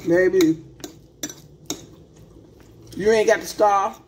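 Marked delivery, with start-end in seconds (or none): none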